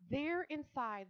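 gasp, then high, slow reveal; gasping wonder. A narrator's reading. Narrator revealing the pig in the carton, amazed. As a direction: draw it out in wonder.

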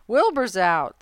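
excited animals spreading word; excited news. A narrator's reading. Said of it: "heard the goose hollering".

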